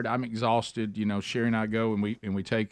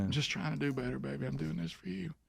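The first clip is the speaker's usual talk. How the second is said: soft; 10 dB quieter than usual. Retold or imitated. Repeats line, softer, to wife.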